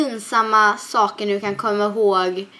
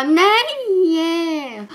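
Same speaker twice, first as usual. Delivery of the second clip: put-on child refusing; 7 semitones higher, 3 dB louder than usual. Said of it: "Och jag bara".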